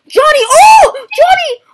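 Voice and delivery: very high-pitched, thrilled cry; excited calling out. Real, not acted. Player urging Johnny to look, excited high cry. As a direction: call the name way up high. Calls friend excitedly about sighting.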